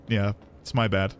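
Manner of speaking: usual speaking voice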